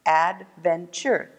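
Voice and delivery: "Adventure" slowly split into ad, ven, ture; syllable by syllable. Shown as a demonstration. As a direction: count out "Adventure" in three slow beats. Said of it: "Three syllables".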